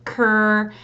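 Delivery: ker glued into one smooth sound; blended. Shown as a demonstration. Teacher showing the glued blend, smooth. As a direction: slide k-er into a single ker. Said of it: "glue it together".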